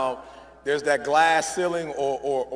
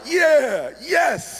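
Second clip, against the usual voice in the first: high, loud happy yes; excited cheer; 7 semitones higher, 4 dB louder than usual. Retold or imitated. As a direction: cheer with delight. Excited dad.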